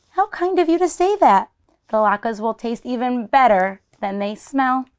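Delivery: put-on an old woman delighted by a compliment; Baba Brina's voice. Baba Brina speaking to bear.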